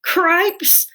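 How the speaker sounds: high, loud startled cry; startled mouse. A narrator's reading. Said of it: "said Furlough".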